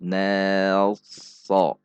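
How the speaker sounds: "nelso" drawn out thin to heavy; "nelso" lengthened only to show the change; stretched illustration. Shown as a demonstration. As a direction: slide slowly from thin "ne" to heavy "so". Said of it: "hanya ilustrasi saja".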